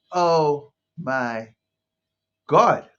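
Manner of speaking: loud, drawn-out exasperated exclamation; a loud, mock-despairing outburst; exasperated oh my God. Real, not acted. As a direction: throw up your hands, loud. Exasperated exclamation about colored community.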